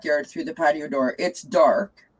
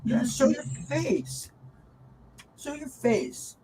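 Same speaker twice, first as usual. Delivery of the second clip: coaxing cat; 8 semitones higher, 5 dB quieter, slower than usual. Real, not acted.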